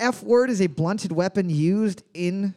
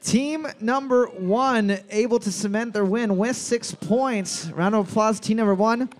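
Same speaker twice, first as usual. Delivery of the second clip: announcer voice; 4 semitones higher than usual. Acted. Host announces winner to bar.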